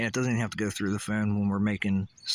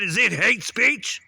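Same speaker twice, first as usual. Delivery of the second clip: slow, much higher and louder question; loud demo; 10 semitones higher, 8 dB louder, slower than usual. Shown as a demonstration. Ranter shows viewers how he said it, loud and slow. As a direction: belt out "hate speech" slowly, pitched way up. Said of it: "Can you hear the way I said".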